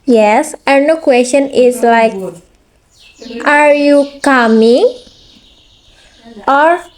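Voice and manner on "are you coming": "Are you coming?" with pitch rising sharply on "coming"; rising intonation. Shown as a demonstration. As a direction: lift the end of "coming" into a yes-no question. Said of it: "is a rising intonation".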